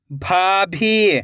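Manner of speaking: भाभी joined as one slow word; blended word. Shown as a demonstration. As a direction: read भाभी whole, falling at the end. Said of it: "खींच के पढ़ना है".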